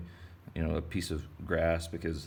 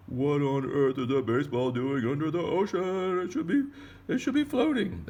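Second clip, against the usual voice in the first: high, loud baffled fish, climbing higher; surprised fish; 8 semitones higher, 4 dB louder than usual. Pretend. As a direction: let the fish's shock climb.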